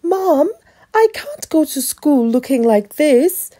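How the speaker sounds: high, loud whine to mom; dismayed child's high-pitched plea; protesting boy. A narrator's reading. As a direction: wail it like an embarrassed kid.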